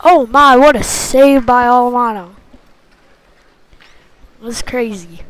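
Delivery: ordinary delivery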